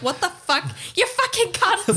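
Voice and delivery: playful Irish rant; angry Irish listener. Retold or imitated. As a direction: spit it out like an offended Irish fan. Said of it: "two listeners in Ireland are like".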